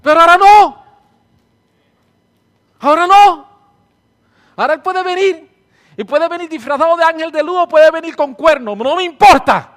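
loud, high full-force declaration; fiery preaching. Real, not acted. Preacher defying the devil before the church, fiery. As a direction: defy the devil from the pulpit.

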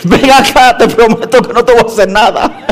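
a light act of a panting, pleading man; breathless pursuer. Acted. Man panting as he pleads with her, mock breathless. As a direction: coax her back, out of breath and laughing. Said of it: "el tipo decía".

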